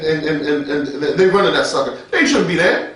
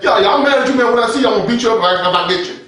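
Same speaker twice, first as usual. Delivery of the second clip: higher, fast, casual threat; everyday street talk; 4 semitones higher, 4 dB louder, faster than usual. Shown as a demonstration. Showing how an angry man really talks, street style. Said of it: "they talk that, like, like that in the streets".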